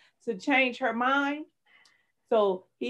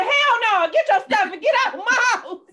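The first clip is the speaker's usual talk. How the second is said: much higher, louder hell no; defiant shout; 13 semitones higher, 8 dB louder than usual. Retold or imitated. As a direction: throw him out with a yell. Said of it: "I said".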